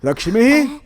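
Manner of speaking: high call of a name; alarmed parent calling; urgent calling. Acted. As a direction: call out to her from behind a door. Calling through the door at night.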